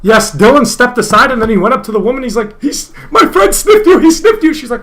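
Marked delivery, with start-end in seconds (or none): 2.6-4.5 high-pitched mock tattling; exaggerated, much higher voice for the quote; mocking tattletale impression. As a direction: mimic him squealing on a friend. Mimics friend tattling to the woman.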